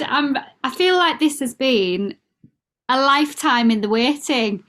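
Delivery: normal talking voice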